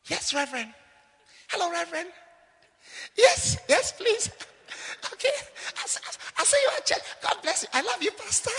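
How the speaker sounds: high, soft, honeyed voice for the pastor; sweet-talking the pastor. Retold or imitated. Bride on the phone with her pastor, cooing sweetly. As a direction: coo at him like a smitten bride-to-be. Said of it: "he say".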